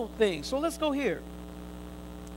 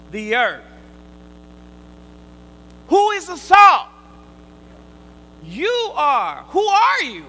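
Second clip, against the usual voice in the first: loud, high, slow preaching cadence; full-force preaching; 4 semitones higher, 7 dB louder than usual. Acted. Preacher telling believers they are the salt, preaching at full force. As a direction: proclaim it to the congregation.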